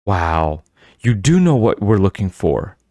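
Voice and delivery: ordinary delivery, nothing marked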